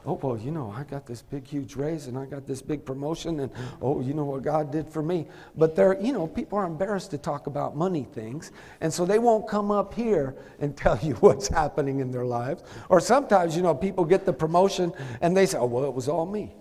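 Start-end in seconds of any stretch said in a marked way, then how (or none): 0.1-5.3 low, hushed imitation of a church member; confidential sharing. Imitates members privately sharing good news. 15.6-16.6 low, soft mock boast; offhand self-credit. Imitates people taking the credit.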